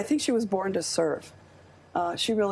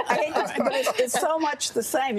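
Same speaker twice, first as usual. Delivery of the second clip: laughing; 6 semitones higher than usual. Real, not acted. Laughing at sarcastic Trump remark.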